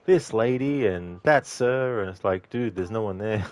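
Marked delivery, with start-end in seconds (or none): 0.0-0.8 higher, louder hawker's call; mock vendor crying out to an empty street. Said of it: "and he's like". 1.2-2.0 higher, louder hawker's call; mock vendor crying out to an empty street. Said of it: "and he's like".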